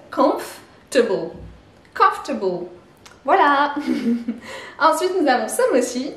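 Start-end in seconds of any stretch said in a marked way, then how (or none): 0.1-2.7 comfortable drawn out slowly, A weak, or gone; English comfortable, slow. Shown as a demonstration. Teacher slowly repeating comfortable for students. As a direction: slowly model comfortable as kumf-tuh-bul. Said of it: "comme si on avait deux sons".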